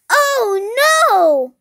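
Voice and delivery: loud, high "oh" falling into "no"; big acted wail of alarm; dismayed mother. A narrator's reading. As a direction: cry out in storybook dismay. Mother reacts to speeding ticket.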